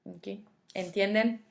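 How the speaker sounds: ordinary delivery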